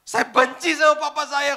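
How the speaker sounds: high, loud and slow, tearful; imitating a sobbing young woman; crying complaint. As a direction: wail it out through tears. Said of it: "Tiba-tiba dia nangis".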